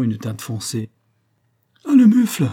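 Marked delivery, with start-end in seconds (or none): 1.8-2.5 high, loud outburst; cry from the heart. A narrator's reading. Wife reacting to her lover's insult, cry from the heart. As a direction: burst out, unable to hold it back. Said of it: "C'était un cri, le cri du cœur".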